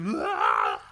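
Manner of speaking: loud imitated release yell. Man yelling under the boardwalk to release stress. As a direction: let out a big demonstrative "ah". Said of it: "sometimes you just gotta yell".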